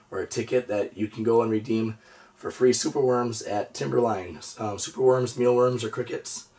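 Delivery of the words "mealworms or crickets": usual speaking voice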